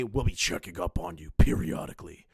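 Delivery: low-pitched imitation of a pompous warning; mock official threat. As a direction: quote him in a low, mocking voice. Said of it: "are you going to say".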